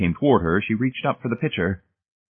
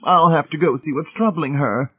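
high-pitched, sighing matron; fussy concern with a hint of a sigh; 9 semitones higher, 4 dB louder than usual. A narrator's reading. Mrs Pritchett about to get up and check, sighing. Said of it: "Missus Pritchett sighed".

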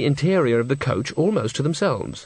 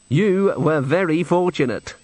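slow, raised, prim pronouncement; 5 semitones higher than usual. Acted. Thin man tells witches they were lucky, primly. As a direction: draw it out with stiff propriety.

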